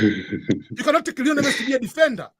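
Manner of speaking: said through a real laugh; amused, chuckling through the punchline. Real, not acted. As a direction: let the laugh break into the words.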